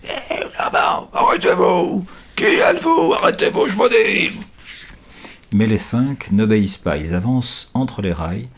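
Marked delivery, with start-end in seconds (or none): 0.0-4.3 loud barking roar, pitched up; man roaring. A narrator's reading. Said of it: "Un homme rugit".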